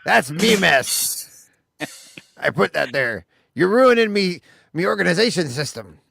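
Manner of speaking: hammed-up indignant pirate; mock pirate protest. Pirate host scolds co-host for messing his notes, mock outrage.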